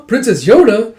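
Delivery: high, loud surprised cry; astonished exclamation. Acted. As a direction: exclaim in delighted disbelief. Soldiers surprised to see princess.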